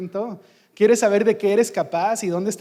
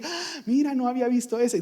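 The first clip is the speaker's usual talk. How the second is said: mock surprise; 5 semitones higher, 3 dB quieter than usual. Imitating someone surprised at parade.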